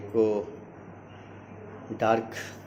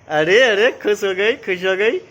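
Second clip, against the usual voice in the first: playful delight; 6 semitones higher, 6 dB louder than usual. Real, not acted. Dad cheering the delighted child, playful baby talk.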